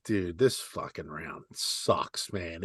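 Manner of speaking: slow, soft, deflated grumble; mock bored grumble. Golfer to his partner about the round, slow soft sulk.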